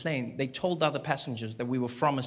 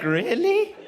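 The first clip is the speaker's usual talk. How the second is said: high, loud disbelief; outraged disbelief at betrayal; incredulous; 11 semitones higher, 3 dB louder, much slower than usual. Retold or imitated. Incredulous reply.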